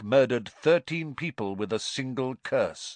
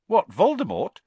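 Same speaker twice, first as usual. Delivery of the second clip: a high, loud blurted question; surprised boy; 11 semitones higher, 8 dB louder than usual. A narrator's reading. Harry asks Stan in surprise, high and loud. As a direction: blurt it out without thinking.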